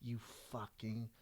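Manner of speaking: slow, soft, low self-reproach; hushed thought in a slow-motion moment; inner self-scolding. Retold or imitated. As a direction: curse yourself quietly, stretching the words out. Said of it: "in my mind where I was like".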